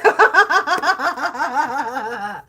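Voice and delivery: high, loud laugh; real laughter; laughing burst. Real, not acted. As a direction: crack up at your own bit. Laughing after voicing carrot.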